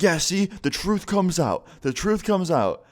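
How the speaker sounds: slow, high-pitched accusing jab; mock vindicated retort. Boyfriend throwing it back at his girlfriend, mock accusing. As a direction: imitate an indignant boyfriend, drawn out and high.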